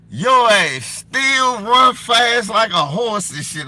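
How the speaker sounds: loud, drawn-out teasing of a friend; amazed teasing. Retold or imitated. Friend's amazed, laughing remark retold.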